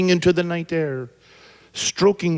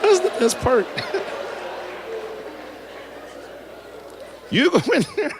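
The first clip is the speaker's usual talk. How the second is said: real laugh breaking through the line; can't get the words out for laughing; 12 semitones higher, 5 dB quieter, faster than usual. Real, not acted. Teller retelling the joke, cracking up. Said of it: "I'm laughing 'cause I know".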